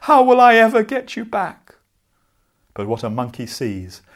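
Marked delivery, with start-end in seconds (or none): 0.0-1.8 much higher, wailing lament over lost hats; crying lament. A narrator's reading. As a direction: cry out to the hats in despair, pitched high. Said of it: "began to cry".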